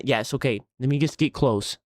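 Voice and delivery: normal talking voice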